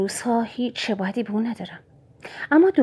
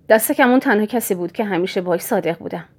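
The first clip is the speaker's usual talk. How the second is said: usual speaking voice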